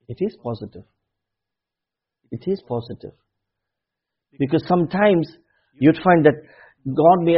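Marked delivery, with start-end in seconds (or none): 0.1-3.1 much softer, lower insistence; preacher gently assuring the doubter; quiet firm assurance. Real, not acted. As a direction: say it softly, as settled truth.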